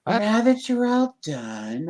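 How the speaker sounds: put-on high, drawn-out Valley Girl; ditzy Valley Girl impression. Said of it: "with my Valley Girl tone".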